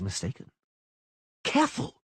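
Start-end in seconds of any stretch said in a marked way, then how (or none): 1.4-2.0 high warning shout from above. A narrator's reading. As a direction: call the warning down. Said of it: "Dandelion shouted from above".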